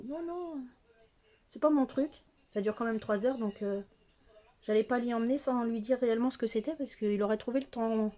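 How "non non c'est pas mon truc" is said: lightly put-on voice of a reluctant dad; imitating her father. Dad turning down the opera, imitated in a higher voice. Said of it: "donc il m'a dit".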